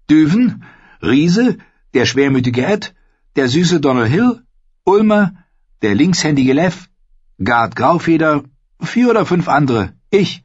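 jeden Namen hoch angesetzt; zähl die Überlebenden einzeln auf, hoch; hohe Aufzählung. A narrator's reading. (Namen einzeln, in hoher Stimme aufgezählt)

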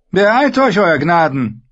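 high, loud hurrying order; urging command. Acted. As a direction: push the king to hurry. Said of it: "sagte er zu Joffrey". (drängender Befehl)

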